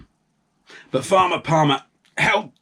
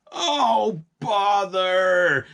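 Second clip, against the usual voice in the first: a shouted, fed-up bother; grumbling farmer; 7 semitones higher, much slower than usual. A narrator's reading. Farmer Palmer grumbling at the spilled pots, shouted bother. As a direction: farmer groaning at the mess. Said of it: "said Farmer Palmer".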